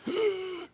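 a sudden shocked gasp; sharp high gasp. Acted. Host caught out, high shocked gasp. [name]'s realization moment.

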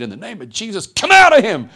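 building to a high, explosive yell; preacher's roar bursting out on the command; shouted exorcism command. Retold or imitated. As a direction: cast the devil out at full force. Acts out casting out devil.

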